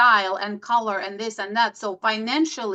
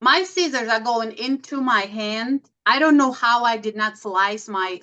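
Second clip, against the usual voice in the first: ordinary talk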